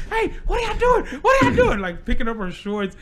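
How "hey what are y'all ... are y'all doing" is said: put-on much higher, louder girlish yell; little sister yelling. Cynthia yelling at the boys in the ditch, high loud. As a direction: shriek it like a chubby little girl. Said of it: "She was like".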